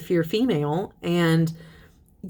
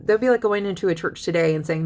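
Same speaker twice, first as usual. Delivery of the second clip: ordinary delivery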